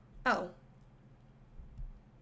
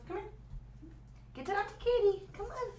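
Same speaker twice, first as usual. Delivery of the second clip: high sing-song coaxing; coaxing dog voice; 11 semitones higher, 7 dB quieter than usual. Real, not acted. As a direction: coax the dog to come over.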